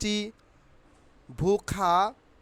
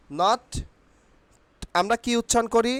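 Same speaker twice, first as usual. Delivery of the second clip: ordinary delivery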